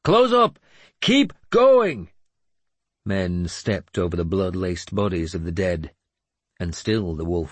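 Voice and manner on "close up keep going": loud, high, urging battle cry; shouted order. A narrator's reading. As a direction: drive the men on at a shout. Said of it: "Finan shouted".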